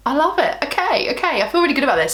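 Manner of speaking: bubbling over, pitch jumping up; excited delight. Real, not acted. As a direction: gush with real delight. Delighted with the finished eye look.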